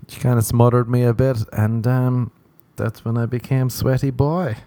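usual speaking voice

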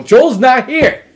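high, loud, drawn-out outburst; hammed-up fed-up yell; exasperated shout. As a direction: blow up at the pestering client. Fed-up lawyer in acted quarrel.

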